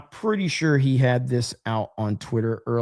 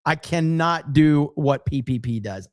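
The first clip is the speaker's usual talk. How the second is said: ordinary talk, nothing marked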